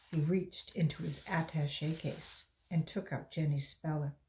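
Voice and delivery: usual speaking voice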